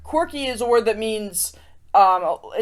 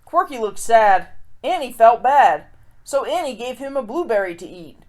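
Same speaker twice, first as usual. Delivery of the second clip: lively rhyming read-aloud; storybook reading. A narrator's reading. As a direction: read it like a picture book to kids. Reads a rhyming picture book.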